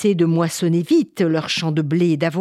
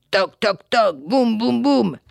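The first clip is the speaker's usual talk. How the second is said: high, punchy spoken knocks; knocking imitation; 5 semitones higher, 4 dB louder, slower than usual. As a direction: pound out each syllable like door thumps. Imitates neighbours banging at the door.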